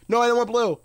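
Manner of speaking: loud, high-pitched objection; frustrated outburst. Real, not acted. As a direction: cry out in protest at a mistake. Game gives the wrong colour.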